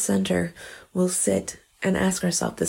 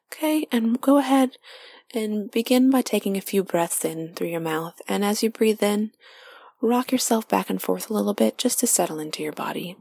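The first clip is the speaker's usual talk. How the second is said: guided meditation; 2 semitones higher than usual. Acted. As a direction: lead them inward gently, half whispered.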